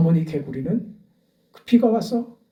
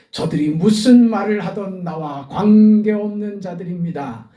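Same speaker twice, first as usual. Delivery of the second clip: louder, higher, rising sermon fervor; a pastor's fervent peak in the sermon; full-force preaching; 5 semitones higher, 6 dB louder than usual. Acted. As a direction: preach it out to the congregation.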